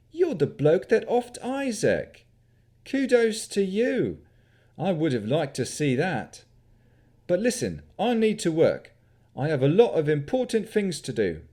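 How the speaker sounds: put-on young wizard's cheeky country yokel accent. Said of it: "His accent mimicked that of a country yokel".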